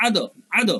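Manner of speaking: আদ with the d bounced at the end; qalqalah echo. Shown as a demonstration. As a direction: release the d of আদ in a short echo. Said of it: "এরকম একটা উচ্চারণ". (আদ-এর দ ধাক্কা দিয়ে উচ্চারণ)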